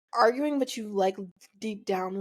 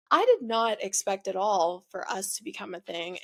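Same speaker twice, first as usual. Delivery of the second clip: usual speaking voice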